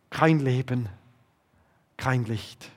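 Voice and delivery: dropping to a soft, low hush; fading. Acted. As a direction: let the list die away into darkness. Listing what Jesus lost. (leiser werdend, tief und gedämpft)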